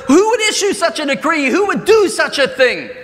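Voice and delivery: angry king. Acted. Furious king demanding who issued the decree, high and loud. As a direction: demand to know who dared. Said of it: "the king, in his anger".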